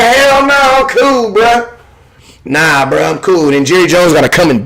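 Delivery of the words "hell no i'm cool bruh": dismissive turn-down. Pretend. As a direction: brush off the offer like he doesn't need it. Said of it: "Be like".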